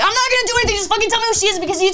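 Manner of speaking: high, loud, hurried retold plea; exasperated insistence. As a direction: reenact pressing him for the name. Retold argument over other girl.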